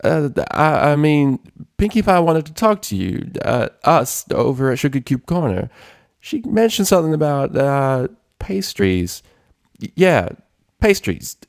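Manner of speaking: high, stammering forced cheer; fake beaming. A narrator's reading. Applejack lying badly about pastries, forced cheerful and high. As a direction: cover a lie with a big fake grin. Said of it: "she beamed, with a wide fake grin".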